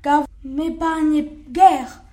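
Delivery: wolf justifying his revenge, furious; raging wolf. Acted. Wolf rages, decides revenge.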